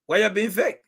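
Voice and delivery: high, pointed accusation of fakery; accusing challenge. Real, not acted. Host challenging a fake supporter in the chat, accusing. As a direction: call the commenter out on being fake.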